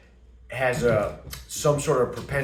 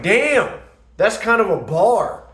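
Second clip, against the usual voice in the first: higher, louder, impressed exclamation; 6 semitones higher, 5 dB louder, slower than usual. Retold or imitated. Boyfriend hypes up girlfriend's line, loud and impressed. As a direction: react as if she just dropped a great line. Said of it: "I was like".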